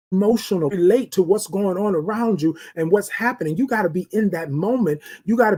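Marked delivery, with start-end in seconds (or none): none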